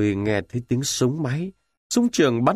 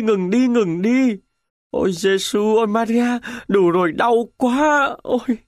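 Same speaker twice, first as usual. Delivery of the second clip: dying soldier sobbing prayers to the Virgin; moaning in agony; 8 semitones higher than usual. Acted. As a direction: beg for death through the pain. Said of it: "Pacini cắn vào tay rồi rên rỉ".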